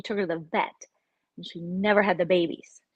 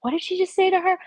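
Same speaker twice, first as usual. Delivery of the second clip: much higher, louder, shocked; shocked husband; 11 semitones higher, 6 dB louder, faster than usual. Retold or imitated. Husband reacting to the show, high shocked outburst. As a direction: gasp it out like he's scandalized. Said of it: "And he was like".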